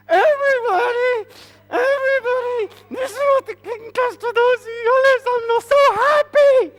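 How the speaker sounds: very high, mock-tearful proclamation; fake-cheerful Haman. Haman leading Mordecai while hiding anger, fake cheer. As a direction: announce it in a strained high wail, hiding anger. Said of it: "can't show everybody he's angry, so he's leading Mordecai around like this".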